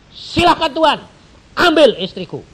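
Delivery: loud surrender. Acted. Surrendering wife to God.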